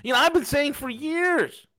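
mock pearl-clutching. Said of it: "many of you are like".

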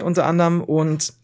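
ordinary talk, nothing marked

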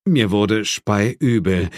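plain reading voice

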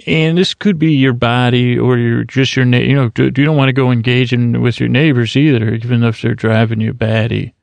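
ordinary delivery

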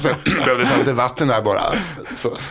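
ordinary talk

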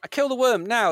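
normal talking voice

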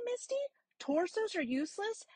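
ordinary delivery

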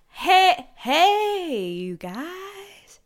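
high, drawn-out hammed-up Southern drawl; Southern accent. Podcaster retrying Stanley's friend, exaggerated Southern accent. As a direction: try a stretched comic Southern accent. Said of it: "so more southern".

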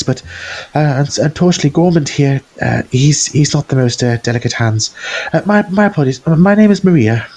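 high, fluttery nervous shopkeeper voice; wiry woman. Acted. Game master voicing the flustered smith's wife. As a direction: play her flustered and eager to please.

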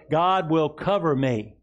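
slow, emphatic words for the church to echo; slow declaration to repeat. Shown as a demonstration. Pastor leading the congregation, slow and firm. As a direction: lead it slowly and firmly. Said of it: "Say it with me".

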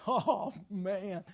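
usual speaking voice

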